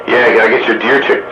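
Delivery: ordinary delivery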